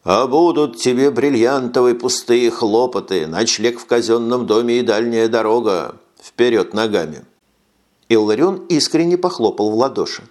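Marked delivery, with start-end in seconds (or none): none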